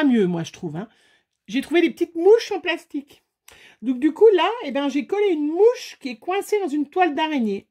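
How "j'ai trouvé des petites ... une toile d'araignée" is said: gleeful reveal. Real, not acted.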